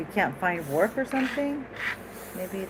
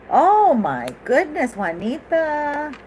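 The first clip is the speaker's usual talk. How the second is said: excited; 9 semitones higher, 6 dB louder, much slower than usual. Real, not acted. Excited card reveal.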